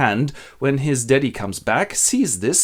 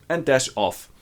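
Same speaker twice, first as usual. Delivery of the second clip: ordinary delivery, nothing marked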